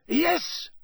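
high, hasty pleading; hurried, nervous. A narrator's reading. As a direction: rush to explain. Karkaroff eager witness.

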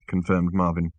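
usual speaking voice

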